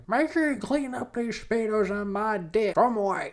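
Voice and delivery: hammed-up scolding from afar; mock yelling back. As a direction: call back in a put-on high voice. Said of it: "she yells back".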